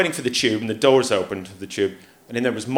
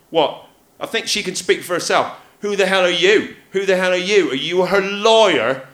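higher, louder, indignant demands; rounding on him, outraged; mock-outraged retort to the big man; indignant challenge; 6 semitones higher, 4 dB louder than usual.

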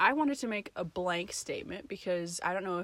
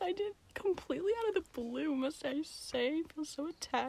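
mock offended; 8 semitones higher than usual. Real, not acted. Mock offended about size.

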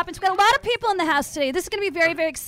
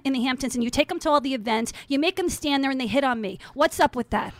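a put-on lower nagging voice; lower mock housewife voice; 6 semitones lower than usual. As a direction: drop lower and complain in character. Acted housewife role.

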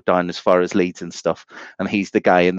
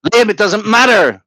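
loud and high, joking; mock shout; 9 semitones higher, 6 dB louder than usual. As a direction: bellow it at a friend.

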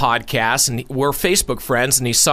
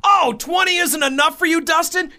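mock scolding; 8 semitones higher than usual.

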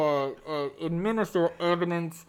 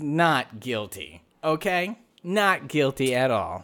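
NOT guilty, slowed right down; slow emphatic not guilty; 3 semitones lower, slower than usual. Pretend. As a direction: lean hard on NOT, slow and deliberate. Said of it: "clearly evidence that my defendant is".

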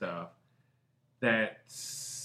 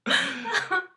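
loud laugh; 8 dB louder than usual. Real, not acted. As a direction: burst of high laughter that falls off.

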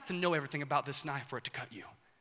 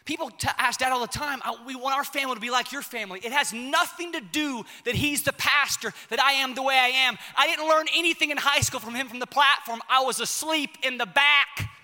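raised, insistent sermon voice; emphatic preaching; 6 semitones higher, 5 dB louder than usual. Real, not acted. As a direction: drive the point home to the congregation.

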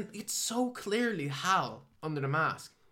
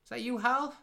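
high mock question; 10 semitones higher than usual. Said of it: "she's like".

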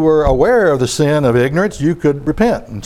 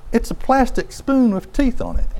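ordinary talk

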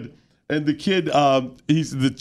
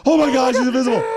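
amazed parents; 9 semitones higher, 4 dB louder, faster than usual. Retold or imitated. Parents reacting to invisible kid.

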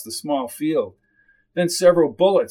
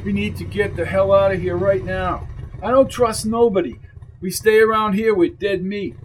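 higher-pitched tough guy pressing to leave; insistent, raised-pitch character voice; tough biker uncle, urgent; 4 semitones higher than usual. Acted. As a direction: voice a wary biker urging escape. Voices Dulio, urging escape.

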